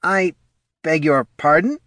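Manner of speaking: high, halting, stunned question; barely managed. A narrator's reading. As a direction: stammer out a polite query after freezing. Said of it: "he managed".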